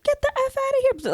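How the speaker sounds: high-pitched, quick mock outburst; retold cussing out. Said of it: "like, going in".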